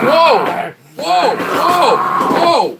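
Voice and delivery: high loud repeated whoas; yelling in real shock; shocked yells. Real, not acted. Repeated yells at game scare.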